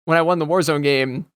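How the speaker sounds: ordinary talk, nothing marked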